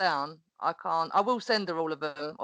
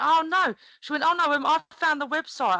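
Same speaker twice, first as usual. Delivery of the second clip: much higher, louder, excited imitation of the teacher; gushing teacher; 8 semitones higher, 4 dB louder than usual. Teacher gushing to the mum that she found the website, excited and much higher. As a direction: gush like a thrilled fan.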